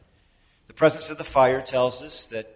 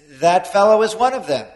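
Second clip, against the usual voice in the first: louder, higher pointed accusation; accusing servant girl; 6 semitones higher, 9 dB louder than usual. Retold or imitated. Servant girl accusing Peter to bystanders, loud and pointed. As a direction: call him out in front of everyone.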